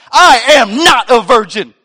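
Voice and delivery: loud, high mock-indignant shout; outraged yell. Brother stung by his sister's insult, outraged mock yell. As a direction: explode in hammed-up denial, word by word.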